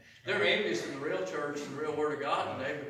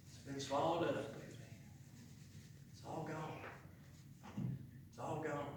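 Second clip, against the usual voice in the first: very soft, quiet repetition; hushed; 8 dB quieter than usual. Acted. Preacher easing the congregation down, very soft. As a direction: let it fall to a hush.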